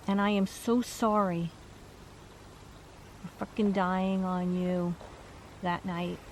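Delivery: hushed, halting relayed regret; softly apologise, pausing as if choked; emotional apology. Retold or imitated. Relaying his apology for dying that night, emotional. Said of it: "He's getting emotional".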